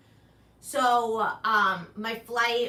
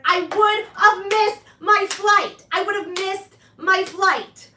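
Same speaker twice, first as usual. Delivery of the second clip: loud alarmed realization; 8 semitones higher, 10 dB louder, slower than usual. Retold or imitated. Realizing she'd have missed flight.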